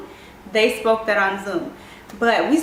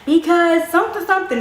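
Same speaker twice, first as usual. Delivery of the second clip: high, loud blurt across the table; loud high outburst; shouting across the table; 7 semitones higher, 4 dB louder than usual. Retold or imitated. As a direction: call it out too loud to the whole table. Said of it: "I must have shouted across the table".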